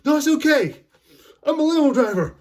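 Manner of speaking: very high, loud comic shout; high-pitched, overconfident holler; hammed-up movie-quote yell. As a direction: yell it like a goofy man rushing past. Quoting Lloyd barging through.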